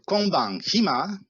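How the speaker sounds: "Konban hima" slow and high, rising on "hima"; question intonation. Shown as a demonstration. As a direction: ask "Konban hima" with a drawn-out lift at the end. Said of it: "só com a entonação a gente já expressa". ("Konban hima" como pergunta, subindo no fim)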